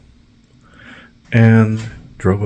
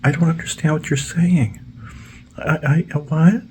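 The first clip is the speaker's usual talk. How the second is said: very high confused voice; high mock confusion; mock clueless worker; 9 semitones higher than usual. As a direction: play dumb up high. Mocking dazed lawnmower man.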